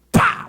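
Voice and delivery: estalo alto de tapa, gritado; tapa imitado. Retold or imitated. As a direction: solta um PÁ! bem forte. (imitação de tapa, gritada)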